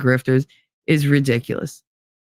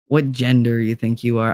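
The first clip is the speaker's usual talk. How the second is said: usual speaking voice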